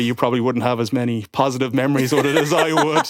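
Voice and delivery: usual speaking voice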